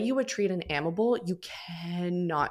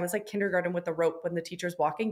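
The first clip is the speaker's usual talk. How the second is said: ordinary talk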